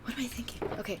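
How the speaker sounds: whispering. Real, not acted. Muttering to herself.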